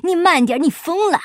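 high, loud panicked cry; alarmed shout. Acted. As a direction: yell at the reckless driver. Guan shouting at speeding Afanti.

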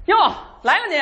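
high-pitched waiter's welcome call; noisy food-stall waiter; loud waiter greeting. Pretend. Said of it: "全得大声的交流".

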